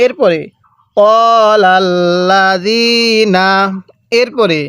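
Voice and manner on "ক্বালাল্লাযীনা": ক্বালাল্লাযীনা very slow, long vowels held; teacher's measured tajweed model of ক্বালাল্লাযীনা; elongated Quranic recitation. Shown as a demonstration. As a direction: stretch the "zee" in ক্বালাল্লাযীনা. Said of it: "এক আলিফ টানতে হবে".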